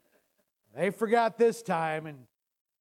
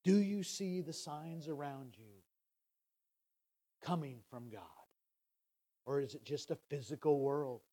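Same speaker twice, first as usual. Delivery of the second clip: lower, much softer, pausing sermon voice; hushed reflection; 5 semitones lower, 6 dB quieter than usual. Acted. Preacher musing on God's signs to the congregation, hushed. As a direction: draw them in with a near-hush.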